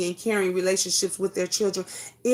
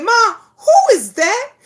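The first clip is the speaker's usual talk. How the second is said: a lightly voiced curious child, higher and louder, slow; mother imitates daughter; 10 semitones higher, 8 dB louder than usual. Mother retelling her daughter's question, child voice. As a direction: play the daughter asking about the voice on the video, lightly voiced. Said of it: "And she said".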